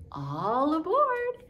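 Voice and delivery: slow, high "all aboard"; conductor call. Shown as a demonstration. Teacher calling all aboard like a conductor.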